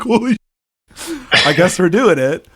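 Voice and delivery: high startled exclamation; stunned surprise. Retold or imitated. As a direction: caught off guard, voice jumping up. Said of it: "And I was just like".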